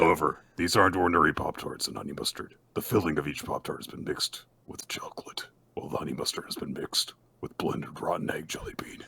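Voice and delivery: hushed, whispery villain voice; sinister half-whispered instructions; creepy killer laying out the game; Jigsaw. Acted. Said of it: "would you have the more appropriate, uh, Jigsaw voice".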